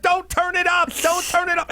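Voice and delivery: very high shrill yell; heated family shouting. Retold or imitated. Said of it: "she's like".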